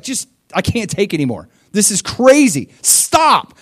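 rising to a loud high stop; exasperated Samuel. Acted. Samuel losing patience with Saul, building to shout. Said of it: "He's just like".

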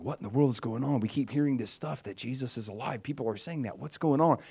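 quietly puzzled, mildly acted; imitating puzzled disciples. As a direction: voice the confused disciples under your breath. Voicing the bewildered disciples conferring.

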